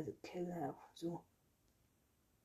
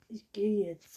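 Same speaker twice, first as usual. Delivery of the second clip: higher, loud parting line; raised-voice declaration in narration; firm announcement; 4 semitones higher, 12 dB louder than usual. A narrator's reading. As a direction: announce it emphatically. Boy announces he is leaving.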